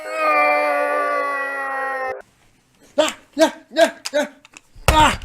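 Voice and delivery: loud high screams; screaming. Acted. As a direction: scream through the change. Screams during transformation.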